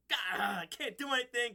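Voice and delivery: mildly exasperated imitation; mock frustrated outburst; flustered, frustrated. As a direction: act out helpless frustration, lightly. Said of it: "flustered and, you know, frustrated".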